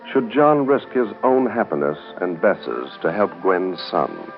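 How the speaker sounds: ordinary talk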